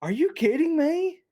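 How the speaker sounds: high, slow incredulous squeal; mock shocked saleswoman; rep's disbelief. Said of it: "she couldn't believe it".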